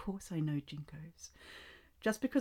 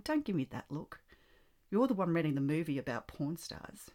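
ordinary delivery

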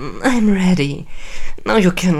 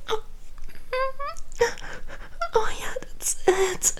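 high breathless cries of pleasure; moaning; 10 semitones higher, faster than usual. Acted. Climax moaning.

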